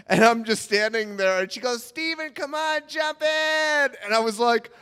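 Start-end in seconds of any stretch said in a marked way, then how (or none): 1.8-3.9 high-pitched imitation of a coaxing girl; mock girlfriend urging him in; girl calling out. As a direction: call him over in a raised, girlish voice. Girl calls boy into pool.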